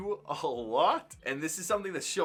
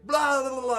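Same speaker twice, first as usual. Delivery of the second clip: high, loud whiny blah-blah; loud, high mock child's tantrum wail; mock tantrum babble; 8 semitones higher, 9 dB louder than usual. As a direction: ham up a toddler's tantrum. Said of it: "He's like".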